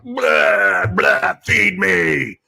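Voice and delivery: slow, drawn-out mock groan; mocking moaning beggar. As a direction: whine it like a lazy glutton being spoon-fed. Mocking welfare recipients as groaning beggars.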